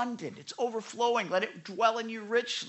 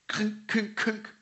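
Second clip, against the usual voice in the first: short higher-pitched imitated clunks; digging clunks; 3 semitones higher, slower than usual. Preacher acting out digging into hidden rock, clunking noises. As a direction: spade striking buried stone, voiced as clunks.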